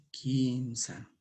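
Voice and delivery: normal talking voice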